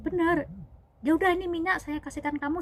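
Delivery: high, loud, fast retort; exasperated conceding. Retold or imitated. Father figure handing over the oil, exasperated conceding. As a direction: imitate a father figure giving in crossly.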